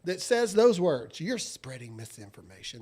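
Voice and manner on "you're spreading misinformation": low, hushed mock quote. Host parroting the critics he can't stand, mocking undertone. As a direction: drop right down under your voice.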